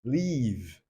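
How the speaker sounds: "leave" with a long ee sound, "liiv". Shown as a demonstration. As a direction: stretch the vowel in "leave". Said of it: "Esses sons são o que às vezes diferenciam". ("leave" com i longo)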